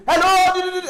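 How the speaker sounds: very high, loud hello; high-pitched yapping. Retold or imitated. Energetic friend answering the phone, very high loud squeal. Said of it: "naturally have that high pitch".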